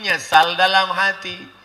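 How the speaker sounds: ordinary talk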